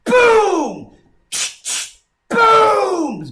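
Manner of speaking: loud, high drawn-out boom; imitated gunshot boom. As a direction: boom it out like a gun blast. Imitating shots in imagined real attack.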